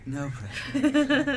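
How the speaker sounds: high, slow, laughing echo. Real, not acted. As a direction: repeat it through a laugh. Host echoes guest's teasing remark.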